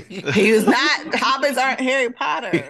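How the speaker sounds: amused, incredulous correction; laughing protest. Real, not acted. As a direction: object while cracking up. Mock-indignant correction while laughing.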